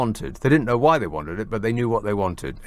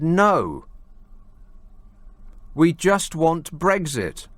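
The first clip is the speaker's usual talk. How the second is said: slow, higher mock Cockney; 4 semitones higher, much slower than usual. Host quoting rally-goer's answer, put-on slow Cockney. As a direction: imitate a Cockney Leave voter, stretching it out. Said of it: "putting on a deep Cockney voice".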